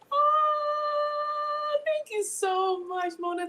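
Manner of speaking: high, slow, touched thanks. Real, not acted. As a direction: gush out a delighted thank you. Touched by viewer's kind comment.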